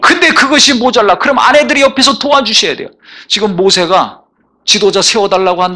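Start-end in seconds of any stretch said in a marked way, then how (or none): 0.0-2.9 higher, building pulpit cry; mounting sermon fervour; preaching at full force. Acted. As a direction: climb toward the altar call. Exhorting fathers.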